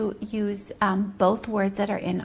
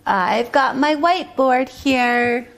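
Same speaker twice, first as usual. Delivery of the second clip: ordinary delivery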